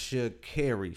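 Sha'Carri said as sha-CARRY; Sha'Carri with "care" in the middle; her own pronunciation. Shown as a demonstration. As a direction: make Sha'Carri end like "carry". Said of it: "She says Sha'Carri".

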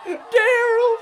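panicked wailing. Acted. Fleeing, wailing over Daryl.

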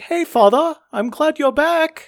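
hammed-up happy daughter with a put-on accent; chipper daughter. Daughter skips up to greet her father, chipper and high-pitched. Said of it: "she's just so happy. She's just chipper".

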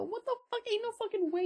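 telling the ex what she thought of his bet, high and shocked; retold outrage. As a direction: shriek your disbelief at the bet. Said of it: "I go".

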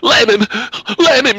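high, loud shriek of command; shrieking girl urging the dog; screamed. Acted. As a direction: scream it out wildly. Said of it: "she screamed".

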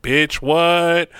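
mimicked retort, controlled volume; imitated cool comeback. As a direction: throw his words back at him. Her retort to his shock.

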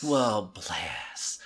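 low, whispery Southern BLESS; hushed, pitying Southern put-down; ultimate veiled insult. Shown as a demonstration. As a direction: let BLESS sink into hushed condescension. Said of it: "Bless is the ultimate".

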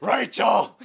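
loud, strained superhero growl; hammed-up hoarse yell of a name; Batman.

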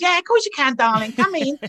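a hint of a welcoming star; imitating Barbara. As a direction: Barbara inviting him into her dressing room, lightly voiced. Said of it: "Barbara was".

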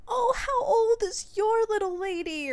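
put-on high, loud, overanimated old lady; mock overwrought lady's voice; distraught dog lady. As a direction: gush it high and loud, all worked up. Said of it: "she sounded really distraught".

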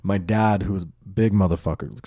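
normal talking voice

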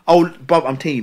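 normal talking voice

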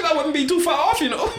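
laughing. Real, not acted. Joking about the casting.

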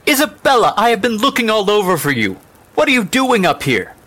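high, loud scolding mother; angry mother. Acted. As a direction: call out crossly to a missing child. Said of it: "her cheeks were flushed with anger".

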